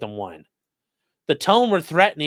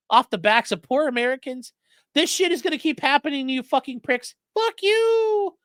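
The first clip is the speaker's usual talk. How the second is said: castrato voice; 10 semitones higher than usual. Retold or imitated. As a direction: ridicule the caller, pitched way up. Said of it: "doing the fucking castrati thing".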